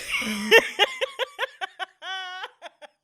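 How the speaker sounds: long, high, wheezy laughing fit; helpless laughter. Real, not acted. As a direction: lose it laughing, unable to speak. Laughing at own misdeed.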